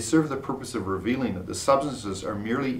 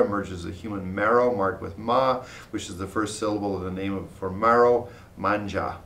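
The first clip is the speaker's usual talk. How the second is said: ordinary talk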